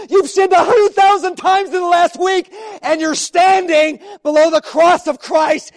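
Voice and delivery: loud, stern scolding of himself; self-rebuking shout. Retold or imitated. Pastor scolding himself below the cross, loud and stern. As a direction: berate yourself out loud for your sin.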